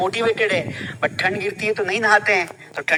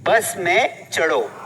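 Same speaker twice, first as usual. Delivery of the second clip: slow, deliberate command; slow model; 3 semitones lower, much slower than usual. Shown as a demonstration. Teacher slowly modelling the command. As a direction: say it slowly for learners to copy. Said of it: "क्या बोला?".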